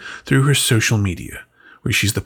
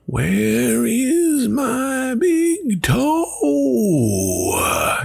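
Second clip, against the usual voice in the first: very high, drawn-out wailing moan; ghostly groan; 14 semitones higher, much slower than usual. Acted. Ghost coming closer to the house, high long wail. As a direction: wail it out, long and eerie, as the thing draws near. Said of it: "it groaned".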